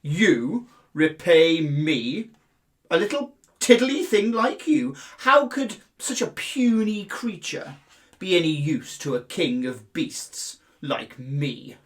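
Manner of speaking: loud, booming mocking lion voice; lion scoffing at a tiny mouse; scornful lion. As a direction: laugh him off like a king of beasts. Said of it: "Lion roared with laughter".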